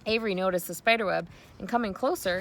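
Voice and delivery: usual speaking voice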